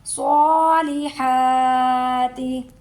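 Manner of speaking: सॉलिहाति with ha from the throat. Shown as a demonstration. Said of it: "हा को हलक से निकालेंगे". (हलक से हा)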